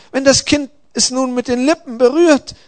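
high, loud worried protest. A narrator's reading. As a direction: object urgently with the voice pitched right up. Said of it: "protestierte er".

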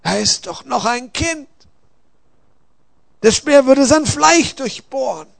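alarmed warrior, voice climbing high; fearful plea. A narrator's reading. Warrior fears spear will pierce child.

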